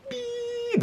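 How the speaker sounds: thin, very high, soft sustained beep; high-pitched ringing. Retold or imitated. Storyteller imitating the sound after being hit, high-pitched ringing. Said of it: "that high-pitched ringing sound".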